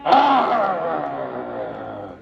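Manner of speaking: low mock bear growl; big playful growl. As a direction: give one big grumpy growl. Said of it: "One more big growl".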